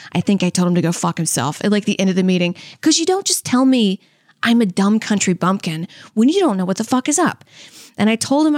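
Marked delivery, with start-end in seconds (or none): none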